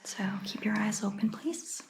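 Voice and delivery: normal talking voice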